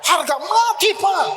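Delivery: mock resolute vow; firm vow. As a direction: declare it with fire. Repeats son's vow.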